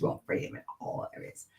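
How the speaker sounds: breathy whispered aside. Real, not acted. To her live viewers, a whispered emphatic aside.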